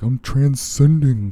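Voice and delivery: slow, high, loud spacey hippie; mock a blissed-out guru; hippy dippy. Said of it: "super hippy dippy".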